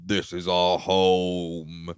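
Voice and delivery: low, soft, very slow creepy line; possessed voice. Retold or imitated. Possessed girl declaring her home, low slow mock creepy voice. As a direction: drag it out low like someone possessed.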